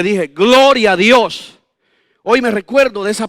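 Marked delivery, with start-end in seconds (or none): 0.3-1.6 fuerte y muy lento, con júbilo; proclámalo despacio, lleno de gozo; alabanza alegre. Retold or imitated. Responde a la promesa de su esposa. (exclamación pausada y fuerte de alabanza)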